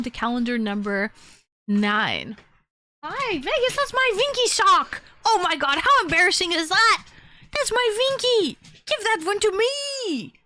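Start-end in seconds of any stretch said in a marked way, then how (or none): 3.0-5.0 high startled squeal; squealing at the dog. Real, not acted. Dog grabs her sock, squealing. 5.2-7.0 higher, louder and fast, embarrassed; higher flustered exclamation; flustered laugh-talk. Real, not acted. Dog shows her sock on stream. 7.5-10.3 high squeal at the dog; high pleading squeal. Real, not acted. Grabbing sock back from dog.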